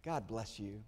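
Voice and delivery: a low, quiet brush-off blessing; dismissive blessing. Retold or imitated. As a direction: bless them and send them away. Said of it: "And here's your response".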